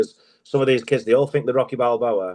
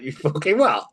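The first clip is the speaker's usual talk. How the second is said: laughing disbelief; 5 semitones higher than usual. Retold or imitated. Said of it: "I was like".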